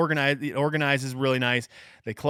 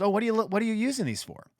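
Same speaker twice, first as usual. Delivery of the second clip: pitched up and rushed, sweet store clerk; high quick craft-lady chirp; 8 semitones higher, faster than usual. Retold or imitated. Retelling the Michael's clerk asking about the bags, high and quick. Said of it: "That's what she goes".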